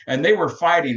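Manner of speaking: usual speaking voice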